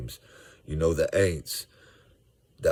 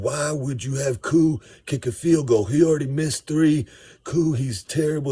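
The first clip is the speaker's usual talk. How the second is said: much higher, louder imitated rant; mock angry rant; 8 semitones higher, 4 dB louder than usual. Negative fan ranting about the kicker Koo, mock angry. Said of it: "he's ranting".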